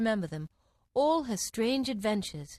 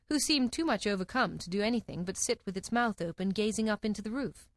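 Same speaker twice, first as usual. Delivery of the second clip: usual speaking voice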